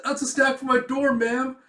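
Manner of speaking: high, loud pleading voice; acted panicky excuse; nervous student. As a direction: explain yourself to a feared teacher. Student caught by strict inspector.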